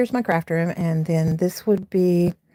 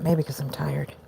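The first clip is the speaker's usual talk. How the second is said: whispered aside; 4 semitones lower, 6 dB quieter than usual. Real, not acted. Muttered aside about being tired.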